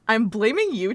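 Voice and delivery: normal talking voice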